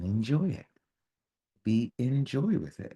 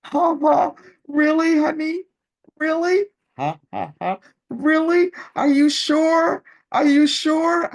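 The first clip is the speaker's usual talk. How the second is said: very high, loud falsetto, honey-sweet fake innocence; mock sweet teasing; 11 dB louder than usual. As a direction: tease the husband with exaggerated sugary disbelief. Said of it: "So I was like".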